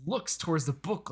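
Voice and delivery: usual speaking voice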